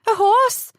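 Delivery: high, loud little daughter calling out; child's shout. A narrator's reading. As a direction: call excitedly for your father. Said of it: "his daughter shouted".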